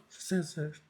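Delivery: very soft, insecure mumble; confused mumble. Retold or imitated. Embarrassed new salon owner telling a client his price, mumbled. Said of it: "Eu falava meio confuso".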